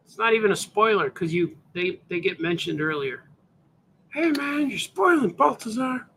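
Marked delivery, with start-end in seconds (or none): 4.1-6.1 heckling voice. Acted. Heckler voice scolds host.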